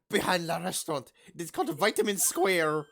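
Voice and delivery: badly faked French accent; hammed-up French accent. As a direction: mangle it with mock French sounds. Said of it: "That's not even French".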